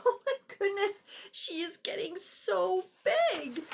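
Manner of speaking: high, wide-eyed exclamation; amazed reader. Real, not acted. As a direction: marvel at how big she gets. Reader reacts to growing alligator.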